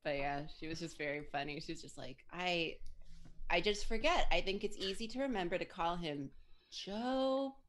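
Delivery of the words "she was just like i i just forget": normal talking voice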